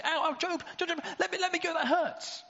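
very high squeaky mock whimper of a beaten sea monster; whiny monster voice. Leviathan begging Job to let go, in a very high mock whine. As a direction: squeal it like a silly hurt creature. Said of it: "Will he say".

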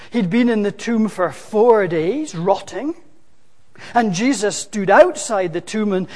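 ordinary talk

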